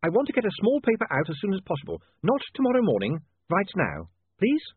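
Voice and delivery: put-on high, pressing young newspaperman; urgent William. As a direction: plead for it to be done right now. William urges printers to rush.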